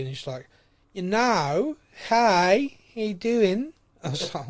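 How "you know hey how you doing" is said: imitating daughter's picked-up Australian accent; higher, louder mock-Aussie greeting. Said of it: "talking with an Aussie accent".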